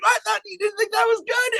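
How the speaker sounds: very high, surprised squeak; mock disbelief. Asking his bandmate in high mock disbelief. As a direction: act shocked he disliked it. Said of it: "And I was like".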